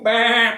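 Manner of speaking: high, loud sheep bleat; sheep baa. A narrator's reading. Animals attack the farmer.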